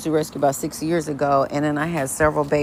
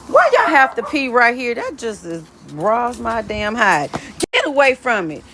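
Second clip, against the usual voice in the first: raised, sing-song voice for coaxing dogs; pet talk; 7 semitones higher than usual. Real, not acted. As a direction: coax the dogs along like toddlers. Talking to her dogs in yard.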